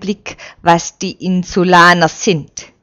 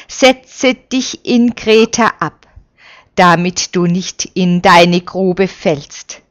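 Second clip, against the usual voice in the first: ordinary delivery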